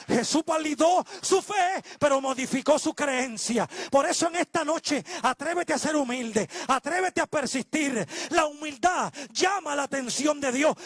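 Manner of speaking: high, forceful exhortation from the pulpit; full-force preaching. Acted. Preacher urging the church to be humble and persist, full-force preaching. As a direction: drive each phrase home to the congregation.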